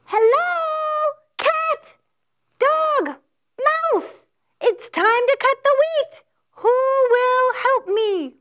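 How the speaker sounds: high, loud clucky hen calling; hen's voice. Acted. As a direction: call out brightly as the hen. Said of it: "So the hen spoke up".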